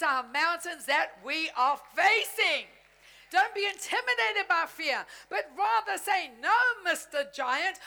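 rising, fervent preaching with laughs in the voice; full-force preaching. Acted. Preacher urging the family to declare war on giants, full-force preaching. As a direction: fire up the congregation.

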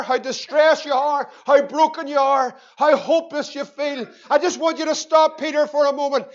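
normal talking voice

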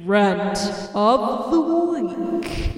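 slow, drawn-out announcer call; game show host. Shown as a demonstration. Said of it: "You have to say it like that, like a game show host".

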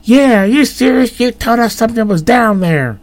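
put-on very high whiny boy; high-pitched kid voice. A narrator's reading. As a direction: pile on like a skeptical kid. Second friend voiced high.

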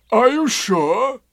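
high, loud, slow donkey wail; anxious, drawn-out question; Eeyore. A narrator's reading. Eeyore asking Pooh about his tail, dismayed.

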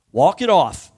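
loud, brisk fatherly command; tough-love father. Acted. Dad to a hurt kid, loud tough-love order. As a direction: order the kid to shake it off, loudly. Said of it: "That's fatherly love".

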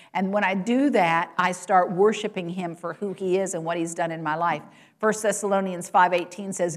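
ordinary talk

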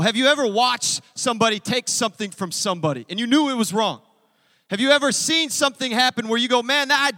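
loud, driving sermon voice; preaching. Acted. Pastor preaching hard to the congregation, loud. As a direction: preach it out to the whole room.

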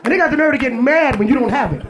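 usual speaking voice

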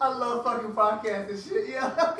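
laughing through the words; giggly, high-pitched laughter. Real, not acted. Laughing while talking.

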